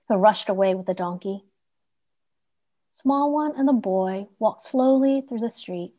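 ordinary delivery, nothing marked

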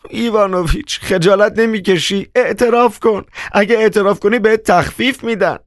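very high, wailing urgency; distraught crying out. A narrator's reading. Wife urges husband to confess, distraught high cry. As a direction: plead shrilly in a very high voice.

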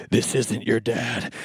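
low, slow, creepy prank voice; sinister stranger. Retold or imitated. Dad posing as an intruder to scare his child, sinister. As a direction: draw it out deep and menacing, like an intruder.

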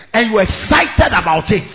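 loud, fired-up preacher shout; preaching at full force. Real, not acted. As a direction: preach it hard to the crowd.

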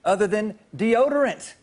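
game-show reveal call; punchline reveal. Acted. Punchline announcement.

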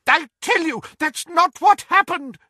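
very high, loud character protest; agitated protest. A narrator's reading. Doge denying Muriel's story, agitated. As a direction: insist urgently, voice pushed right up.

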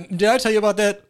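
mock voice. Mock voice asking friend.

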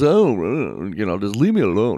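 lightly voiced aloof cat; a mild brush-off from a standoffish cat. Host voicing a cat that wants to be left alone, aloof. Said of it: "they're so aloof".

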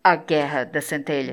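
deep, booming trailer announcer voice; movie trailer voice. Shown as a demonstration. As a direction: announce "A Guerra da Centelha" like an epic movie trailer. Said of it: "Tem que ouvir com a voz do trailer".

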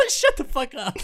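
high-pitched, laughing dismissal; mock exasperation. Fed up at hearing fish weir, high mock outburst. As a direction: squeal it in mock disbelief. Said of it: "and I'm like".

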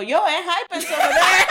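high, loud shout to a crowd; retold call-out. Telling people where the group is, loud mock announcement. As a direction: holler it out laughing.

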